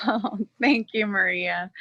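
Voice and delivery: thanks with a light laugh. Real, not acted. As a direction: laugh it off to keep from crying. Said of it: "you're gonna make me cry".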